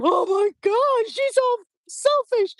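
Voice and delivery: imitating sulky daughters crying; whiny crying kids. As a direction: daughters whining about mom in a high mock sob. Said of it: "doing this screaming and crying".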